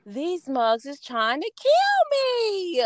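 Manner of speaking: high, slow exasperated quote; drawn out high disbelief; stretched high mock complaint; retold outrage. Retelling pain during procedure.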